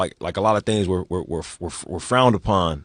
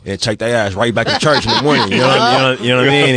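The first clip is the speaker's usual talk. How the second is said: laughing it out, louder as it goes; 3 semitones higher, 5 dB louder than usual. Real, not acted. Host laughing with his co-hosts, getting louder. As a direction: chuckle through the punchline, rising up loud.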